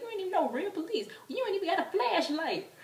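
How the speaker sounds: high, quick indignant yell; squealed, mocking accusation; yelling at police. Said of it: "we started yelling at the police".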